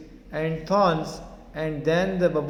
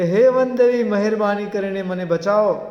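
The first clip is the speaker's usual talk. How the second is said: loud, high-pitched appeal, without real sobs; crying plea; 4 semitones higher, 6 dB louder than usual. Acted. Bubble tree begs the forest goddess to save it, crying out. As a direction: plead loudly, acting distressed. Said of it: "વૃક્ષ રડવા લાગે છે".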